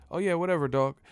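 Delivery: high mock try-hard voice; trying to sound down. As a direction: imitate a realtor overplaying casual cool. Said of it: "he just kept doing that".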